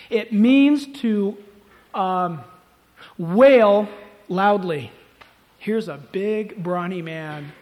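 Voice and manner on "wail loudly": slow, loud stress on "wail"; drawn-out emphasis. Shown as a demonstration. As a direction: WAIL stretched long and loud. Acts out meaning of wail.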